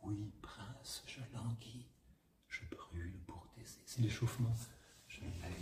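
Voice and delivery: breathy whispered verse, very soft; whispered Racine warm-up; near whisper. Shown as a demonstration. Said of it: "proche du chuchotement".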